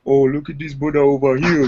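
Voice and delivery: put-on African accent greeting. Comedian teasing someone who looks straight from Africa, mock African accent. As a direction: playfully imitate an African accent. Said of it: "straight from Africa".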